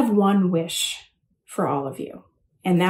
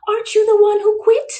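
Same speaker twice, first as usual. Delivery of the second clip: mom's voice pushed much higher, rising in disbelief; mock mom voice; 13 semitones higher, 5 dB louder than usual. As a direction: play the puzzled mom, pitched way up. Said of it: "And my mom was like".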